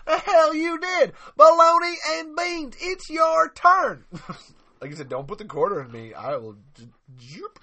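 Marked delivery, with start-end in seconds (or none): 0.1-4.0 loud, high yelling, slowing at the end; shouted movie quote. Acted. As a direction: bellow it like an outraged cook.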